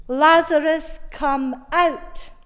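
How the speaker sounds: loud, ringing summons; loud calling out. Acted. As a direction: call out loudly, summoning someone from a tomb. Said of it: "he called out in a loud voice".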